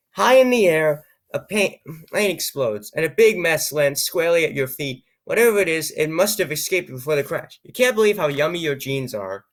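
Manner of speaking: reading the adventure text aloud, lightly dramatized; story narration. Acted. Reads the game story text aloud.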